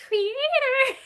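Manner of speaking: high, loud laughing call of creator; puppet voice. Retold or imitated. Students' puppet calling the teacher, high laughing call. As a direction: savor the grand title with a laugh.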